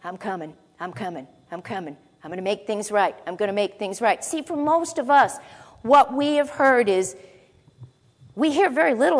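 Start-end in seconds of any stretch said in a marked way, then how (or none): 0.0-4.2 hushed, low voice of God calling out a promise. Retold or imitated. Preacher voicing God's promise, hushed and low. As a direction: speak as God, softly and intimately. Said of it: "of God saying".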